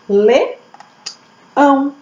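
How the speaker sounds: "Leão" in two parts, Le... ão; teacher's two-beat "Leão"; syllable by syllable. Shown as a demonstration. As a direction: break "Leão" after Le, each part held. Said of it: "elas são divididas em uma e duas sílabas".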